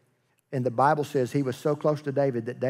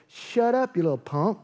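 put-on mildly annoyed older brother, pitched up at first; mock angry; 5 semitones higher than usual. David's brothers telling him off, mock angry. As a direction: snub him with mock anger. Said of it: "his brothers get mad at that".

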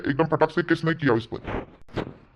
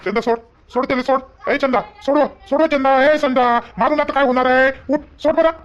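urgent shouting; 6 semitones higher than usual. Acted. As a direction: break up the beating. Father pulling mother off son.

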